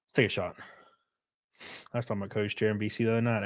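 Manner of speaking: ordinary talk, nothing marked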